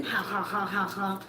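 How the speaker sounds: low mocking ha ha; mock laugh. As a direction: fake a taunting laugh. Mock laugh in acted scene.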